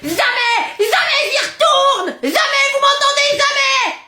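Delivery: high, drawn-out tantrum refusal; defiant shouting. Acted. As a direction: yell your refusal at the parents. Child refuses to go to school. (refus crié, rebelle)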